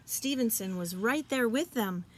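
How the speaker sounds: ordinary delivery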